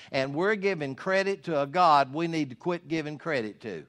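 ordinary talk

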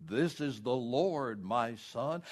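slow, solemn heavenly voice; measured and weighty, each word drawn out; the Lord. Retold or imitated. God names himself to the hanging man, slow and solemn. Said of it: "a loud voice, just almost like a thunder".

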